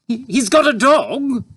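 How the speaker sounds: very high, loud alarmed mother; anxious cry. A narrator's reading. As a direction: stammer in panic. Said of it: "cried Mother anxiously".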